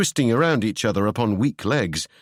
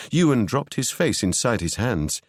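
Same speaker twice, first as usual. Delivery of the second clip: ordinary delivery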